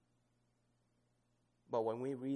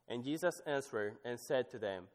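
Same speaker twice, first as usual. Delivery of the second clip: ordinary talk, nothing marked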